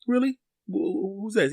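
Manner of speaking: high, soft, stumbling imitation of a friend; unsuspecting friend. Friend asking who the new doctor is, high imitated curiosity.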